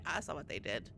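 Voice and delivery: low, quiet ominous mutter. Shown as a demonstration. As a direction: mutter it darkly under breath. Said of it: "repeats line with emphasis".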